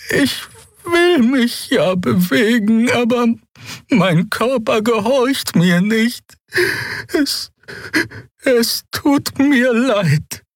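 very high, slow, halting voice; paralysed with fear. Acted. Terrified Xea explaining her body won't obey, halting and fearful. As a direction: struggle to get each word out. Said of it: "Sie schien vor Angst wie gelähmt".

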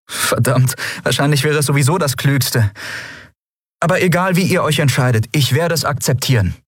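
earnest character voice, high throughout; captain's earnest speech. Acted. Captain continues her speech.

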